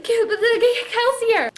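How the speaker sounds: excited, laughing. Retold or imitated. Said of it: "I was just so excited".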